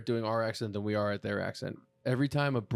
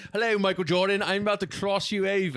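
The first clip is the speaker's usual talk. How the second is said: loud, high-pitched hammed-up British accent; cartoon Brit, high and loud; mock British accent; 8 semitones higher, 5 dB louder than usual. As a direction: announce it in a silly British voice. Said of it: "they caught him really talking in his real voice".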